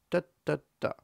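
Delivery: "Da, da, da" as three separate equal beats; evenly spaced, equally weighted "da"; equal stress beats. Shown as a demonstration. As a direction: tap "da" out straight, like a march. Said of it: "equal stress".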